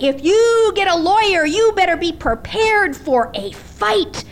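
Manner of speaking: retold fury. Grieving widow threatens her husband's ex-wife, in a retold furious outburst. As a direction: hurl it like a widow who won't back down. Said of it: "it was just fueled into an explosion".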